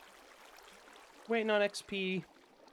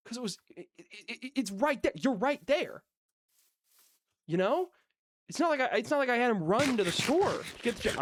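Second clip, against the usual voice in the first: rising heated rant, higher and faster; getting worked up; 8 semitones higher, 5 dB louder than usual. Real, not acted. Ranting about the chili again, getting worked up. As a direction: voice climbing as the anger comes back. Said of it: "I'm getting worked up again".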